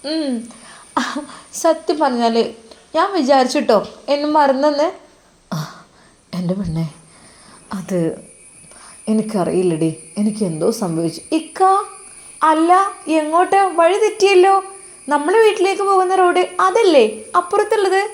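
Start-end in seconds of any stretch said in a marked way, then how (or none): 0.1-4.9 put-on higher, brighter young wife's voice; young wife Nusaiba. Wife Nusaiba teasing her husband, in a higher young woman's voice. 11.4-18.0 put-on higher, louder young wife's voice, puzzled; a young wife asking where the road went, pitched high; worried young wife. Wife Nusaiba asking her husband about the wrong road, high and worried.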